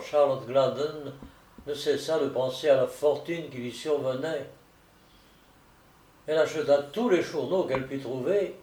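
ordinary delivery

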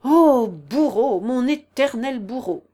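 loud, slow, anguished reproach; exasperated lament. A narrator's reading. General's wife blames her eternal tormentor, exasperated lament. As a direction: wail the accusation loudly, drawing it out.

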